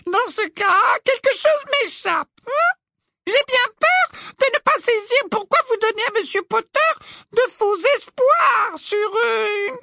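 very high, slow sugary voice; falsely sweet schoolmistress; simpering. A narrator's reading.

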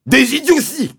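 high, explosive shout; Mister Hazel crying out. A narrator's reading. Landowner rejecting the father's explanation, high scornful shout. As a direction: screech it dismissively. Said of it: "s'est écrié mister Hazel".

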